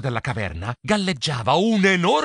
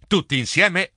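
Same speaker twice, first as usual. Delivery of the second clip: ordinary delivery, nothing marked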